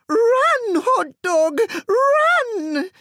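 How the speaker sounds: very high, loud frantic cry. A narrator's reading. Missus Fortini warns Hot Dog to flee, high frantic cry. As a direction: scream a warning. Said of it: "cried Missus Fortini".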